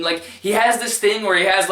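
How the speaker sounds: ordinary delivery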